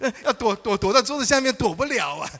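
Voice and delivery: high, loud panicky wail; comic panic at being caught; mock panic. Panicked wish to hide. (高声慌张叫喊)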